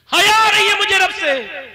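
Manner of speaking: sudden high loud wail of shame; anguished cry. Acted. Girl's plea retold in sermon. (شرم سے چیخ کر)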